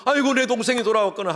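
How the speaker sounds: put-on older son welcoming brother with father's heart, glad; joyful welcome.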